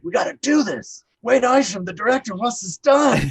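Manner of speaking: rally the strangers to push; urgent rallying. Retold or imitated. [name] rallying the PAs to rock the train, urgent. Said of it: "I was just like".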